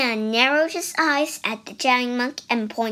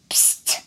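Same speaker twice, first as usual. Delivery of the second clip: unvoiced whispered "psst"; secretive psst. A narrator's reading. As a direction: get someone's attention secretly. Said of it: "said the voice".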